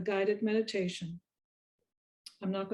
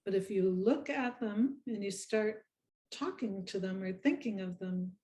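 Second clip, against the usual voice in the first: guided meditation. Acted. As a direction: lead them gently through each breath, unhurried.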